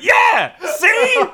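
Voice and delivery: very high, loud comic retort; triumphant mock shout. Joking retort to the baby's mother, high mock shout. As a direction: yell it back as if point proven. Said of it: "And I went".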